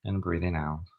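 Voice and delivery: calm meditation guide with long pauses; guided meditation. Acted. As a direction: guide them gently through each breath, leaving space between phrases.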